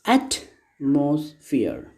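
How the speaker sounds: "Atmosphere" slowly as AT-mos-phere, weight on AT; "Atmosphere" in three slow beats, first one stressed; first syllable stressed. Shown as a demonstration. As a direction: stress AT in "Atmosphere", leaving gaps between syllables. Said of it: "the first syllable has been stressed".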